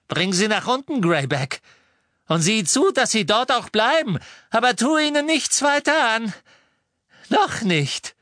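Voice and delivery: high, loud villainess orders; imperious shrieked command; shrill command. A narrator's reading. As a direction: bark orders, climbing to a shriek. Villainess orders her henchman about.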